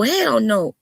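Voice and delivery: normal talking voice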